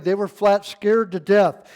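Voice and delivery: ordinary talk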